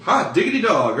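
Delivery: ordinary talk, nothing marked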